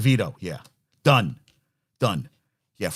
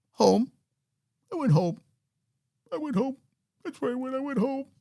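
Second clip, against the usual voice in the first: small, high, soft put-on voice; meek has-been; 13 semitones higher, 3 dB quieter than usual. Forgotten quarterback admits where he went, meek. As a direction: answer sheepishly, high and fading softer.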